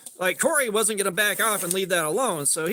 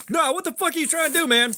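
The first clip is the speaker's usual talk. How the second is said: high, sharp shouted demand; retold yell; 8 semitones higher, 3 dB louder than usual. Friend yelling at him from the back room. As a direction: yell it again, high and urgent.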